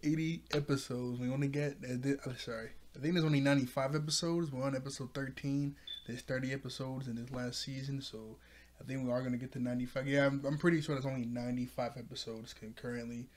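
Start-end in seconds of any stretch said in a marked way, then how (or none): none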